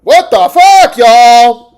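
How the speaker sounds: loud, high disbelief; incredulous shout. Real, not acted. Streamer shouts to chat in disbelief.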